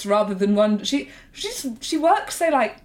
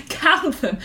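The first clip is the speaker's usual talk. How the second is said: laughing, higher repeat; 5 semitones higher than usual. Retold or imitated. Repeat of the same request.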